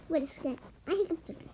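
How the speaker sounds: usual speaking voice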